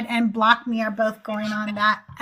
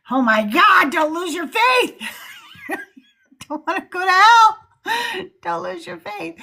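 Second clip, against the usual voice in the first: mock church scold; 7 semitones higher than usual. Mocking church warnings about doubt.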